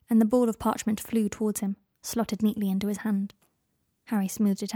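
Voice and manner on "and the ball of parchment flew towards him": ordinary delivery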